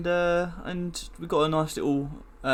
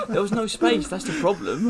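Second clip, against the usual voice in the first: laughing; 7 semitones higher, 5 dB louder than usual. Real, not acted. Laughing at the username.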